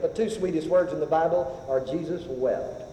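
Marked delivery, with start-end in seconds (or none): 1.8-2.8 slow, soft and lower; tender reverent quote. Acted. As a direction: savor each word tenderly. Savoring sweetest words.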